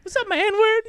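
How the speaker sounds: high-pitched cocky greeting; high, jaunty mock hello; Chris Tucker. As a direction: imitate a breezy swaggerer, voice way up. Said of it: "Chris Tucker's going around. He's like".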